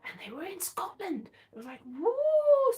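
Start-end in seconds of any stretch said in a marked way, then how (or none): 1.8-2.7 high, louder "woo"; excited whoop. Retold or imitated. Finding ancestors in Scotland, high excited whoop. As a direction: whoop it high in re-enacted delight.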